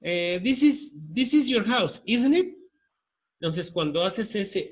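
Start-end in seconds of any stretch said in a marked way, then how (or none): 0.4-2.6 isn't it lifted higher and louder at the end; rising tag question. Shown as a demonstration. Teacher showing the student the tag tune, higher and louder at the end. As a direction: raise the voice on the tag. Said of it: "la pronunciación es la misma".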